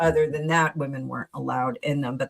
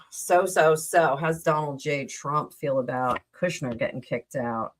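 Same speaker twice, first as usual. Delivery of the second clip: normal talking voice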